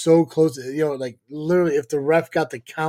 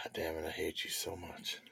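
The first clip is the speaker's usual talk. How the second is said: muttered; 10 semitones lower, 19 dB quieter than usual. Pretend. Joking hate, aside.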